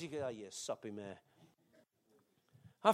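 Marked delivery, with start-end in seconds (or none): 0.0-1.2 a hint of a wife teasing softly, very quiet; lightly voiced wife scoffing. Wife teasing soppy husband at movie, quiet mock scoff. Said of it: "Carol says".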